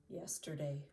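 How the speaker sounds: normal talking voice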